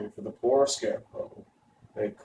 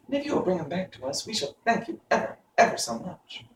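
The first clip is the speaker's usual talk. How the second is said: put-on eager, higher girl's voice; 8 semitones higher than usual. A narrator's reading. Dorothy to the stork, eager and higher. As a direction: press the point eagerly. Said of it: "said Dorothy eagerly".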